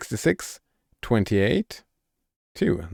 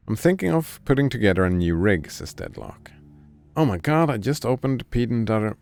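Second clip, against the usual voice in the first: ordinary delivery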